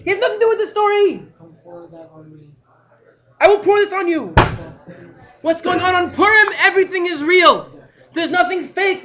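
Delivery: loud, high, driving sermon voice; fiery preaching. Real, not acted. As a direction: hammer the lesson home to the crowd. Preaching Purim lesson loudly.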